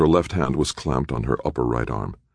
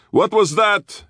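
high, loud yelled question; yelling; 5 dB louder, slower than usual. A narrator's reading. Guard calls into the entryway about a noise, yelling. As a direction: shout it into the building, pitched way up. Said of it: "he yelled into the depths".

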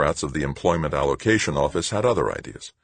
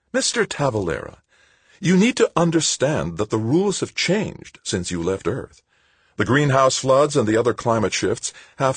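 put-on high-pitched, talking down to a small child; patronizing; 7 semitones higher than usual. A narrator's reading. Bureaucrat to Tavalera as if to a kindergarten pupil, patronizing. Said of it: "as if he were a kindergarten pupil".

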